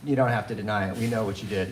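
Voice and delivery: low, flat authority voice; stern dorm director. Retold or imitated. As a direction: talk down to him like the dorm boss. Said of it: "he's like".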